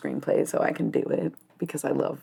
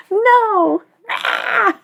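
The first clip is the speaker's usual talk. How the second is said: very high giggly squeal; embarrassed squeal; 4 dB louder than usual. Acted. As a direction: shriek a nervous refusal through giggles. Acts out freezing when put on spot.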